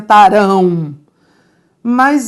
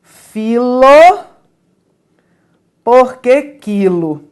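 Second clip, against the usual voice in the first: quoting Jânio Quadros; 4 dB louder, slower than usual. Retold or imitated. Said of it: "atribuída a Jânio Quadros, que dizia assim".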